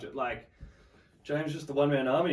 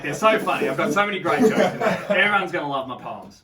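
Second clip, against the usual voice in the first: louder, laughing through the words; laughing while mocking; 5 semitones higher, 11 dB louder than usual. Real, not acted. Laughing as he mocks his teenage self's poems. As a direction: crack up at his naive younger self.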